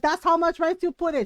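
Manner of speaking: mock scolding as if it's obvious; exasperated mom. Mom scolding daughter about rice, exasperated. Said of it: "And my mom goes".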